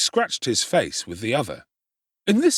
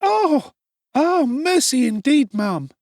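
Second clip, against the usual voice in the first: fervent Charlotte; 6 dB louder, slower than usual. Acted. Charlotte agreeing.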